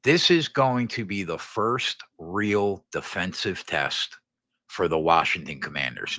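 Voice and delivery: ordinary talk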